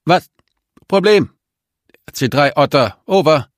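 high, broken-up radio voice; clipped words through a crackling speaker; over a radio. A narrator's reading. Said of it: "aus dem Lautsprecher".

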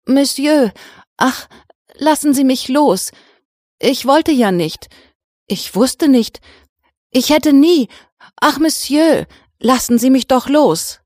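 high, loud, terrified begging; whimpering plea. Acted. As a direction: beg for mercy in pain and panic. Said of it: "Das Opfer wimmerte schmerzlich auf und brach zitternd". (wimmerndes, panisches Flehen)

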